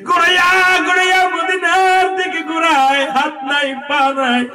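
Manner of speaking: high, loud sermon narration; full-force preaching. A narrator's reading. Preacher tells of the limbless son rolling to Medina, loud high preaching. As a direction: drive the story forward in a high cry.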